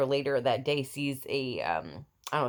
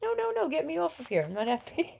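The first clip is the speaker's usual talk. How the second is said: put-on much higher voice for the fussing baby; voicing the baby; 14 semitones higher than usual. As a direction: speak for the unhappy infant, high and whiny. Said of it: "She's like".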